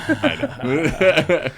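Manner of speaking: laughing it out. Retold or imitated. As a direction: repeat it through a laugh. Repeating her line laughing.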